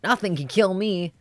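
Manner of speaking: put-on an excited little boy bragging about his day; excited kid's diary. Kid diary voice continues.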